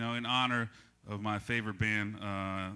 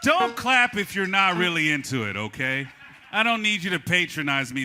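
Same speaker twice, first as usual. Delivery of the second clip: loud, raised voice telling the crowd off; mock scolding; 5 semitones higher, 9 dB louder than usual. Host telling the audience not to clap, mock-indignant and loud. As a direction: scold them in mock offense.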